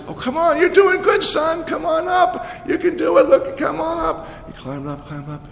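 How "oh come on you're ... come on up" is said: much higher, coaxing call up a ladder; encouraging painter. Retold or imitated. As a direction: coax the boy upward, bright and high. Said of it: "the guy said".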